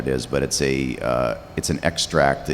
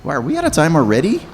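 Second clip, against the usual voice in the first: much higher, louder and quick, startled; surprised; 12 semitones higher, 6 dB louder, faster than usual. Real, not acted. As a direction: gasp it out, caught off guard. Surprised the panel is over.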